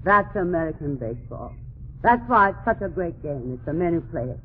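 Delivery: usual speaking voice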